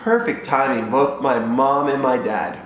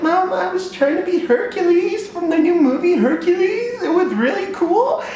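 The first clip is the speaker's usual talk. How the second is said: put-on much higher eager child voice; excited kid. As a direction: gush like a proud little boy. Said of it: "And I was like".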